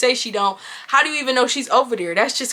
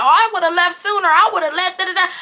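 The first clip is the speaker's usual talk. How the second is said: higher-pitched mimicry of critics; mocking commenters; 5 semitones higher, faster than usual. Woman imitating viewers who would have left sooner, mocking. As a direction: parrot the know-it-all viewers in a higher pitch. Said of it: "Y'all gonna be like".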